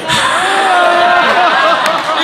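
high, loud mock crowd shriek; crowd scream. Whole crowd shrieks as the comic is insulted. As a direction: let out a big group yell. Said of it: "we all go".

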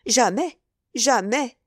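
firm, repeated denial; emphatic sincerity. A narrator's reading. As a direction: insist with full conviction. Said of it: "un accent qui n'admettait pas le doute".

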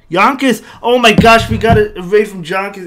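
loud, high-pitched burst of delight; excited surprise. Real, not acted. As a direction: exclaim it, thrilled by a surprise guest. Surprised by a big incoming raid.

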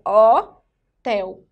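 slow, loud HOTEL split as "ó... tel"; open O. Shown as a demonstration. Teacher shows where the syllable splits, open O. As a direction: hold the open first O of HOTEL. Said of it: "Então, sai aberto".

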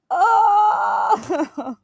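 high, drawn-out cry; real high moan breaking into laughter; distressed wail. Real, not acted. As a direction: wail it out in shock. Still reeling from the scare.

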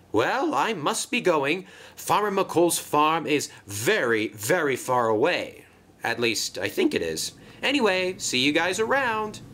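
storybook engine covering uncertainty; breezy, unsure Marion. Acted. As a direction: make a hurried, bluffing exit.

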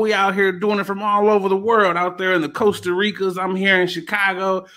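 high-pitched, rolling host patter; high, lively announcer voice; hype show intro. Acted. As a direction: keep the hype up like an MC.

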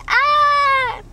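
high loud shriek; mock horror scream; terrified scream. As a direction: scream back in fright. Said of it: "they're like".

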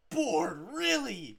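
loud, rising into high disbelief; exasperated shout. Real, not acted. Player scolding his pet, shouted in exasperation. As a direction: yell at a wayward pet.

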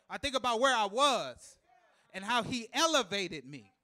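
loud, high preacher's cadence; preaching at full force. Acted. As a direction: preach it, building and rolling.

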